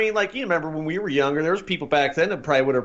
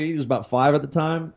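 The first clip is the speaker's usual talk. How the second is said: ordinary talk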